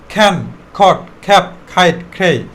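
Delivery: খ্যান, খট, খ্যাপ, খাইট, খ্রেই, each K puffed; separate, punched words with a burst of air after every K of খ্যান, খট, খ্যাপ; aspirated K drill. Shown as a demonstration. Teacher models the new list, aspirated K. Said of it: "এখন থেকে খ্যান বলবা".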